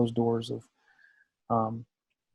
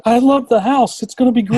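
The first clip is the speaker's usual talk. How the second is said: imitating his wife's thrilled, much higher and louder gushing; delighted squeal; 12 semitones higher, 9 dB louder than usual. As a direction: gush it high and loud like she's suddenly sold. Wife's delighted reaction, retold.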